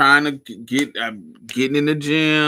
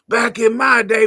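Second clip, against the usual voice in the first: put-on much higher shouting old lady; 11 semitones higher, 10 dB louder than usual. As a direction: holler like a cranky granny. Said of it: "I wanna be talking shit".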